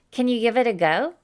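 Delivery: usual speaking voice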